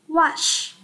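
"Wash" said whole and clear; whole word. Shown as a demonstration. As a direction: model the full word "Wash". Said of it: "Chúng ta sẽ đọc là". (đọc trọn từ "Wash")

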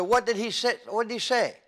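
mild mock alarm of a stuttering boy; urgent boyish question. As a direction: demand to know what he said. Boy asking friend urgently.

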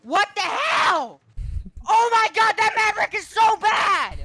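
high, loud raging yell at the enemy; furious shout. Real, not acted.

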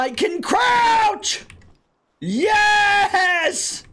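high, loud burst of joy; real gamer whoop of delight; excited shout. Real, not acted. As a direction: yell it out, thrilled. Excited about crouching in game.

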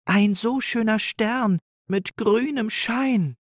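high, small timid voice; shy little doe. A narrator's reading. Little Rike speaks from behind her sister, high and shy. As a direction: peep out bravely from behind. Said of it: "sagte sie hinter dem Rücken".

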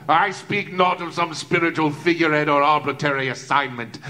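ordinary talk, nothing marked

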